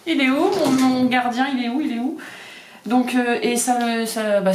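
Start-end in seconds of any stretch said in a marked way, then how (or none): 0.1-2.2 voicing the worried cat. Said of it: "il se demande ce qui se passe".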